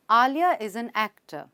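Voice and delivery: slow, tone falling on "actor"; falling statement tone. Shown as a demonstration. Teacher showing learners a statement's tone, falling on "actor". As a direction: state it slowly, letting "actor" drop at the end. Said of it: "The tone falls at the end of a statement।".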